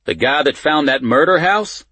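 high, loud disbelief rising through the line; astonished question. A narrator's reading. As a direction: ask it loudly, incredulous. Official astonished at prisoner's identity.

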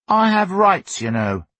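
put-on high, loud boyish assertion; stout. A narrator's reading. Son asserting his rights to parents, stoutly. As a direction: stand up stoutly for yourself. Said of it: "he said stoutly".

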